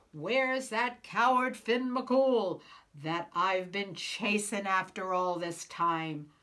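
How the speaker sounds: loud, slow and threatening; giant's booming demand. Acted. Giant at the door calling for Finn, slow booming roar. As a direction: boom it out like a bully giant.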